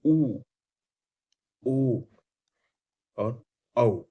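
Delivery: ऊ ओ औ called out loud, lips rounded; vowel demo. Shown as a demonstration. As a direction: model the rounded vowels loudly. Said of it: "जैसे आप बोलिए". (गोल होंठों से ऊ ओ औ ज़ोर से बोलें)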